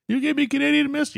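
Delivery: surprised. Retold or imitated. Friend discovers cheap whiskey trick.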